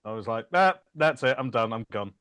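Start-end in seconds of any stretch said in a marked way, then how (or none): none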